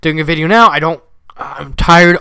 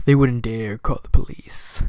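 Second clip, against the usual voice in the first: whisper; 4 semitones lower, 5 dB quieter than usual. A narrator's reading. Ominous narration drops to whisper.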